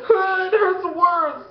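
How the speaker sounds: high, drawn-out cry of pain; mock pained wail. Kid after salting his cut, mock agony. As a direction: wail like a hurt kid, hammed up.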